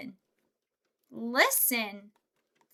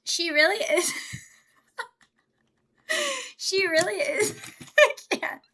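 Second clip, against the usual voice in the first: high voice breaking up with laughter; can't get it out for laughing; 7 semitones higher, 3 dB louder than usual. Real, not acted. Streamer cracking up at a chat message, laughing. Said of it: "now that I'm laughing".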